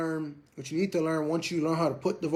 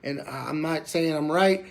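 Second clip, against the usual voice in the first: ordinary talk, nothing marked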